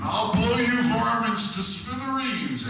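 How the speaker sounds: high, loud, slow cartoon bellow; Yosemite Sam. Comic contrasting the two phrases, loud high bellow. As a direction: roar it like the cartoon cowboy. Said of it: "It's a huge difference between".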